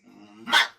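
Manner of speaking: loud smacking mwah; punch sound effect. Retold or imitated. Mimicking his punch landing, loud smack.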